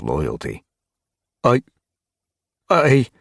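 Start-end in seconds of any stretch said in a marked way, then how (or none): 1.4-3.1 high, loud stammer; falter, unable to answer; flustered false starts; lost for words. A narrator's reading. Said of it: "Gorseclaw was lost for words".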